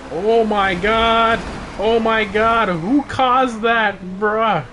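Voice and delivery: loud, high, drawn-out disbelief; real stunned shout at a wrecked race; shocked shouting. Real, not acted. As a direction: yell it out at the chaos.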